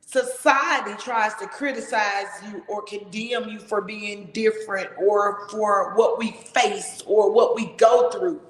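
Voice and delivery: ordinary delivery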